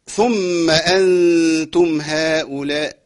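slow, drawn-out chanted recitation; Quran recitation. Acted. Teacher recites the verse for the class. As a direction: stretch the long vowels slowly.

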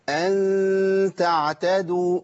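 slow recited أن تعتدوا, the nasal ghunna held long and kept light; light ikhfa with a smile. Shown as a demonstration. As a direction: stretch the ghunna of أن thin and light, a slight smile on the lips. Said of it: "تاني".

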